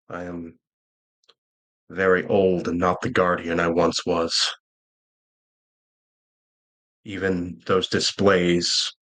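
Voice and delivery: put-on low, solemn old guardian confiding defeat; weary old guardian. Game master voices weary old guardian.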